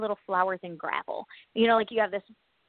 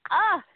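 high, loud squeal of glee; delighted yelp; 7 semitones higher, 6 dB louder than usual. Real, not acted. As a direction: squeal with relief. Delighted at no packing.

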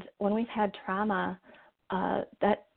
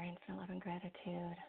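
soft spa whisper; 15 dB quieter than usual. Acted. Mantra on the biggest breath.

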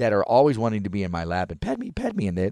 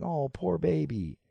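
higher, soft, slow cooing; cooing pity; 8 semitones higher, 5 dB quieter, slower than usual. Retold or imitated. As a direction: coo over her like a baby. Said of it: "friends came around her going".

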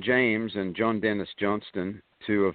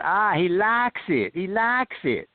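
higher, slow Southern holler; yelled Southern accent; 9 semitones higher, slower than usual. Retold or imitated. Assistant director announces to crew, mock Southern yell. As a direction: call it out in a Southern twang, stretching LIKES. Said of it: "and then he yelled out".

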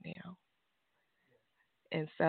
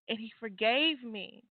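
ordinary talk

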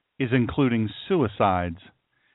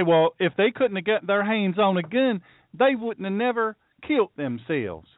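put-on silly Southern accent; 6 semitones higher than usual. Host mocking the other side's gun argument, silly Southern accent. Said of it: "I did that in a silly, silly accent".

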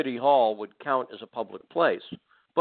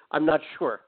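ordinary talk, nothing marked

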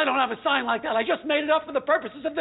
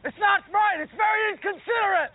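higher, raised bickering; arguing with Norm on the course; heated quarrel; 6 semitones higher than usual. Acted. Golf quarrel escalating.

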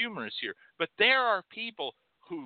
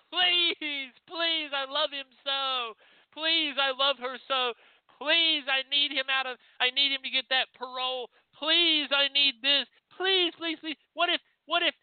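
begging client; 8 semitones higher than usual. Retold or imitated. Client to reader: high, begging please over and over. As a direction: plead like someone desperate to get a lover back. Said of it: "they will beg you, beg".

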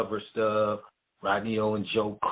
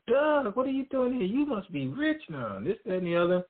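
much higher, excited, imitating the surprised coworkers; surprised greeting; 13 semitones higher than usual. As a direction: mimic them all shouting his name in surprise. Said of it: "everybody there was like".

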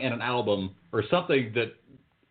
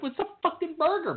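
high-pitched mock outrage. Recalls YouTube hosts calling burgers sandwiches, mock outraged squeal. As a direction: squeal it like you can't believe it. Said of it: "I'm like".

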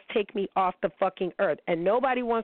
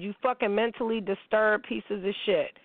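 ordinary delivery

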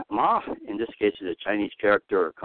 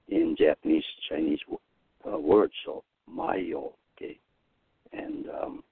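normal talking voice

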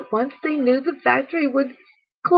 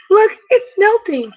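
higher and louder, pointing it out; alarmed cry; 8 semitones higher, 5 dB louder than usual. Acted. An animal points at the melting snowman, alarmed. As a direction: call out in alarm.

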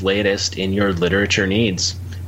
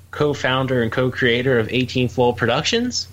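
normal talking voice

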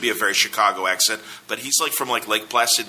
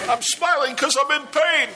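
pug's voice. Pretend. Voicing a drawn dog.

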